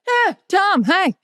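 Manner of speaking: high-pitched startled greeting, imitated; quick high yelp of an awkward hello; Larry David. Imitating a flustered man greeting a star, high-pitched. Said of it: "And Larry goes".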